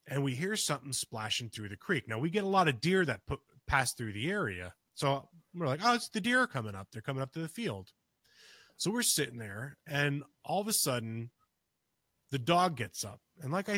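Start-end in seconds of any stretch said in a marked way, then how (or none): none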